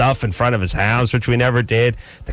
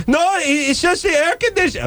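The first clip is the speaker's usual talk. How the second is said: much higher put-on voice of a pestering neighbor lady; neighbor woman calling. Neighbor lady summoning Adam to look, in a much higher put-on voice. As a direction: squeal for Adam like a nosy neighbor.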